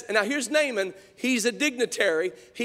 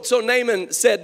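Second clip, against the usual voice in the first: normal talking voice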